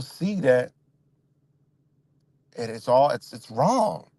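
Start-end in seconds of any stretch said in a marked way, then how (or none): none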